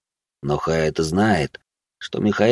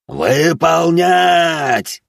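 long-drawn high yell of command; furious drawn-out shout; director bellowing; 9 semitones higher, 4 dB louder, much slower than usual. Acted. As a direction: scream the order, stretching it out. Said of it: "завопил директор".

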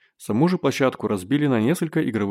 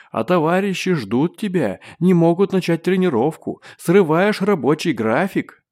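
higher, louder angry roar; 6 semitones higher, 3 dB louder than usual. A narrator's reading. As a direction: scold him at full force. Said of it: "грозно заревел Чейд".

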